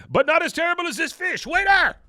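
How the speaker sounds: hammed-up indignant yell for the waiter; high loud mock fury at the fish; outraged Martinez.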